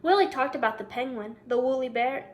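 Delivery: ordinary delivery, nothing marked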